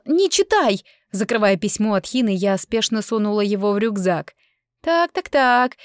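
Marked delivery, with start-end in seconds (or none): 0.1-0.9 high, sudden exclamation; alarmed protest. A narrator's reading. Stopping sister reading her letter. 4.8-5.8 high, put-on nosy sing-out; teasing discovery. A narrator's reading. Sister discovers the secret.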